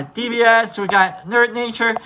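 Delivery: usual speaking voice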